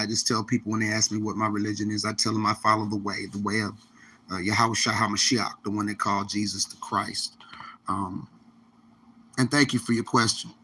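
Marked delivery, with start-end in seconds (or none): 2.9-8.3 whispered. Real, not acted. Reverent mention of Jesus.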